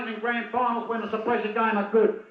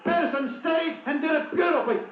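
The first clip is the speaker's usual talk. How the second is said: excited shouting; 5 semitones higher than usual. Real, not acted. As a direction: call the goal at full excitement. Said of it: "I'm getting excited. I'm losing my voice".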